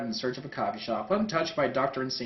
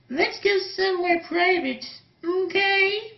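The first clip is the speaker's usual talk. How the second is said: much higher, coy suggestion; high girl voice; 5 dB louder, slower than usual. Acted. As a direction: suggest it shyly as a young woman. Nostalgia chick whispers to Mars Girl.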